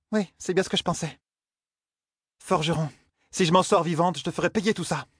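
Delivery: high-pitched, aggrieved huntress; resentful Zarine. A narrator's reading. Huntress blames the blacksmith for her plight, high and resentful.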